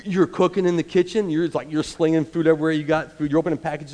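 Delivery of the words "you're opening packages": normal talking voice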